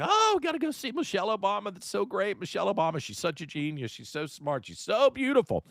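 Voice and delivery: high-pitched, sped-up mock fawning; sarcastic imitation of adoring fans, squealing high; gushing fans, mocking. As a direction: gush in a put-on voice like a starstruck admirer. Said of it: "God, everybody would be".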